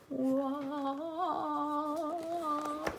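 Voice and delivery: breathy, awed whoa; amazed gasp. Real, not acted. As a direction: let it out in awe. Unboxing the big surprise.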